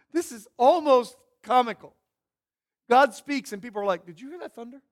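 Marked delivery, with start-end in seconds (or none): 4.1-4.8 puzzled bystander. Retold or imitated. Voicing baffled crowd after God speaks.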